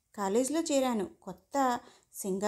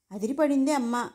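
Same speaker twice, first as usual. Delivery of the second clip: ordinary delivery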